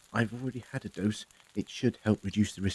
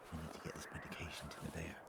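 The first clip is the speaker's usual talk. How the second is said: quick low whisper; 7 semitones lower, 19 dB quieter, much faster than usual. Acted. Doctor whispering to his companion about the medicine, fast whisper. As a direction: hurry it out under your breath. Doctor speaks aside secretly.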